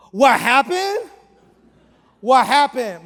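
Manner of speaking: loud, high confrontational challenge; aggressive mocking shout. Angry train rider challenging the man he kicked, aggressive shout. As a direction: throw it back like you want a fight. Angry stranger throws the question back.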